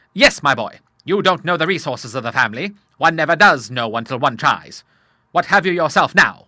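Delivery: loud, confident father; rally your son as a bluff sailor; hearty Admiral. Acted.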